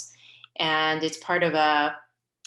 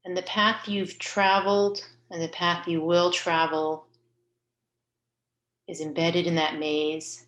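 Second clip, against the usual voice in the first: guided meditation. Real, not acted. Leader guiding listeners to picture their soul's path, calm guided meditation. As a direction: guide them gently inward, leaving space between phrases.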